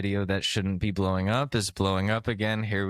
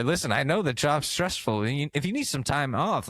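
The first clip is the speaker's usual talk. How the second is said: ordinary talk, nothing marked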